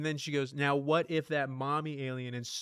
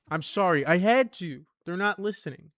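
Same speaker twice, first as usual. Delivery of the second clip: loud exasperated; 5 semitones higher, 8 dB louder than usual. Real, not acted. Strikes villagers that won't listen.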